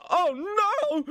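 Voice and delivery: very high, loud comic wail; mock terror. Jeff seeing Bonnie walk out, mock terror. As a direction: wail it in hammed-up panic.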